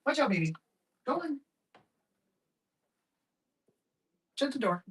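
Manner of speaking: small high voice, off to the side; talking to pet. Real, not acted. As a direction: coo quietly, up high, as to a pet. Talking to the cat off mic.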